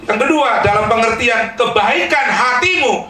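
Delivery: loud, high and slow, driving the point home; forceful preaching. Real, not acted. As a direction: preach it out strong to the hall. Pastor stresses point to congregation. (berkhotbah dengan lantang dan tinggi)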